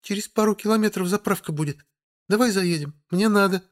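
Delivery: high whiny doll voice; pouting doll demanding a stop; capricious doll voice. A narrator's reading. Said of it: "голосом капризной куклы".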